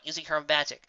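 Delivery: ordinary delivery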